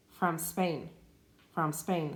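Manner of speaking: "from Spain" run together with no E before the S; teacher models linking for a Spanish learner, clean S cluster; linked as one word. Shown as a demonstration. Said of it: "imagine it's the same word".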